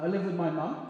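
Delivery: usual speaking voice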